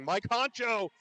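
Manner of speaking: loud, high cheer of the name; excited shout. Real, not acted. Watching the tournament, cheering Mike Honcho, excited shout. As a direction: holler it out like a fan.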